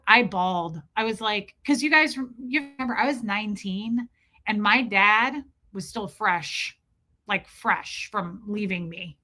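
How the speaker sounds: plain reading voice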